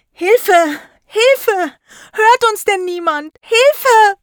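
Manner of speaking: high, loud cries for help; desperate shouting. A narrator's reading. Trapped pupils calling through a wall, desperate shouting. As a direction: call out desperately, stretched and high.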